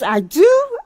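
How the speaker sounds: put-on a child jolted by the news; startled little boy. Boy told he has self-control issues, loud high startled cry. Boy surprised in retold story.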